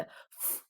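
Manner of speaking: unvoiced puff of air on "f"; F like spitting. Shown as a demonstration. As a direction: blow "f" as if about to spit. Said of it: "like, spit, but you're not gonna spit".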